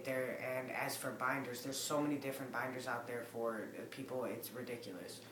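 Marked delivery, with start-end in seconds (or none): none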